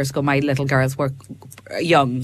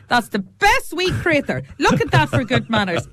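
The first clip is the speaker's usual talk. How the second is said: proud grandad; 9 semitones higher than usual. Retold or imitated. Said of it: "And Daddy was like".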